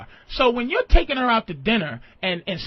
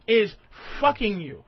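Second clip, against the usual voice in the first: usual speaking voice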